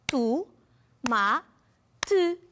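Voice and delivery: "Tu, ma, te" split into three beats, one clap each; clapped syllables. Shown as a demonstration. Teacher claps the syllables of tomate for pupils, beat by beat. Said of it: "Sílabas".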